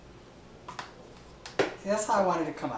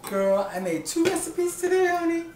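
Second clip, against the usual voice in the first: high, loud sassy boast with a soaring honey; campy diva bragging to her viewers; 13 semitones higher, 4 dB louder than usual. Acted. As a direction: flaunt it, girl.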